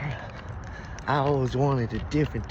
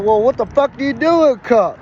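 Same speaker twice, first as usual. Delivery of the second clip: mock high clerk voice; 8 semitones higher, 7 dB louder than usual. Clerk voiced in a retold story.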